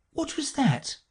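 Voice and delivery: put-on high-pitched inquiring girl; Alice. A narrator's reading. Alice inquires about the course, high and curious. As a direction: ask lightly in a high voice. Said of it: "inquired Alice".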